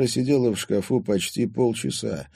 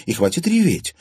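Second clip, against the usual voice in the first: stern, higher-pitched reproof; 11 semitones higher, 3 dB louder than usual. A narrator's reading. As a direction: tell a friend off firmly. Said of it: "строго сказала". (строго)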